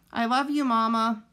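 lightly voiced child goose; young goose, tender. A narrator's reading. Son goose hugging his mother.

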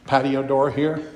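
usual speaking voice

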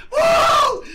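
very high, long "woo"; piercing celebratory holler; ecstatic whoop. Real, not acted. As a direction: let out a victory whoop. Celebrating a huge gambling win.

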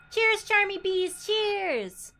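high loud cheer; excited toast. Real, not acted. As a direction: raise a glass and cheer. Toasting a chatter.

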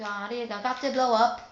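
excited shout. Real, not acted. Gamer reacting to a blow-up.

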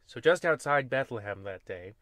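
normal talking voice

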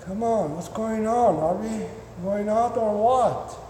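acted puzzled, deflating pet; impatient waiting dog. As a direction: plead as a dog fed up with waiting. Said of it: "After fifteen minutes".

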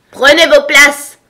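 high, booming slow shout; huge, drawn-out royal order; thundering. A narrator's reading. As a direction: bellow the command like thunder. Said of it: "cria la reine d'une voix de tonnerre".

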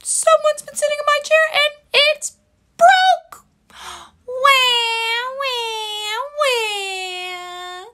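put-on very high, loud, whiny baby wail; crying Baby Bear. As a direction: wail like a tiny bear who lost his breakfast. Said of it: "And Baby Bear said".

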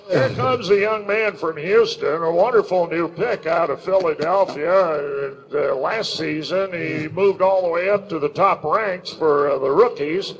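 tipsy play-by-play call; Skip Carey. Shown as a demonstration. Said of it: "kind of a drunk Skip Carey".

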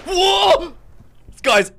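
loud startled yelp; jumpy. Real, not acted. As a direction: jump in fright and cry out. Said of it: "I'm really jumpy right now".

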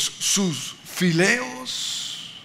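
lingering on "fileos" to make a point; emphasized Greek word. Shown as a demonstration. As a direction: stretch "fileos" out long. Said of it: "enfatizo la palabra".

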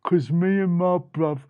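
swaggering mouse backing up his bruv; boastful big brother. Acted. Big brother agreeing with little one, boastful. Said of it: "And the big brother said".

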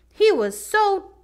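plain reading voice

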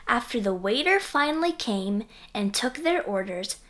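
usual speaking voice

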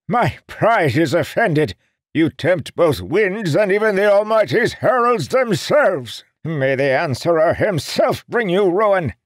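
put-on very high, loud cursing old man; furious curse. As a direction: hurl a curse in outrage. Seer curses them.

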